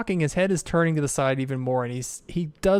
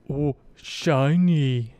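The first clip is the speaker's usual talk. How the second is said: ogre voice; much slower than usual. Acted. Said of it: "He goes".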